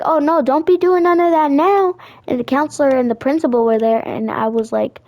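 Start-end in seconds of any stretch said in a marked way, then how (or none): 0.0-2.0 sassy scolding. Retold or imitated.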